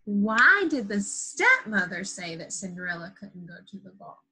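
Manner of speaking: normal talking voice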